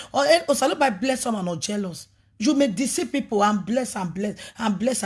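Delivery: ordinary talk, nothing marked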